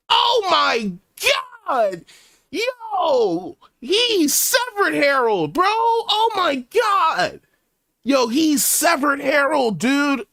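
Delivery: real high-pitched scream of shock. Real, not acted. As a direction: scream it out, shocked and near tears. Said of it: "I'm not, I'm not screaming this".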